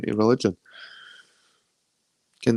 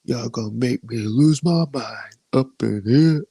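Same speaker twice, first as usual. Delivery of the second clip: [name] impression; slower than usual. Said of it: "was it D M X had done that?".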